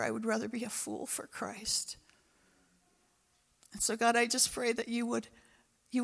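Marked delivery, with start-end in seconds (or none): 0.0-2.0 whispered conviction. Real, not acted. Preacher whispering his vow to the congregation, hushed. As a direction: drop to a breathy whisper.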